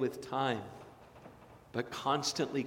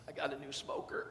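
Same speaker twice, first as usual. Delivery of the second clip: sheepish aside; 10 dB quieter than usual. Real, not acted. Aside confession.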